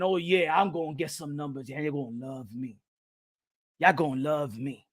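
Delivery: very low, hushed voice; low sly aside. Real, not acted. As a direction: drop right down and say it softly. Sly aside about easy numbers.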